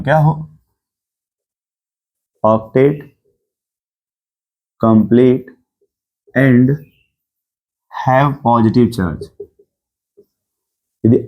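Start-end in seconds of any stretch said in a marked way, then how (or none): 2.4-5.5 word by word, long pauses between; note dictation. Shown as a demonstration. Teacher dictating a note to students, measured dictation. 6.3-9.2 slow, drawn-out words with long gaps; note dictation. Shown as a demonstration. Teacher dictating a note to students, slow dictation.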